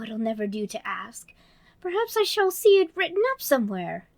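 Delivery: put-on high, bright little girl voice; Alice voice. Narrator voicing Alice, girlish and eager. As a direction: play young Alice talking to herself.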